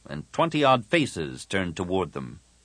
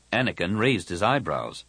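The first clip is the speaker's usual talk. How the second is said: plain reading voice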